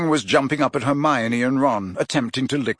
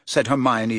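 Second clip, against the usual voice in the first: plain reading voice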